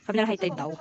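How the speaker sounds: ordinary delivery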